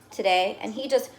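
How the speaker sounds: usual speaking voice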